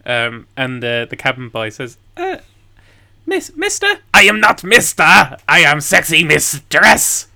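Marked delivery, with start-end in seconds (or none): 2.2-3.9 put-on high, hesitant young boy; timid cabin boy. 4.1-7.3 loud, slow, grand indignation; indignant mistress. Acted. GM voices indignant captain.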